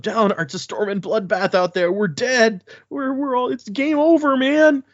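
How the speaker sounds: a nobleman losing it completely; panicked high prince. Acted. Panicking high prince reports defeat.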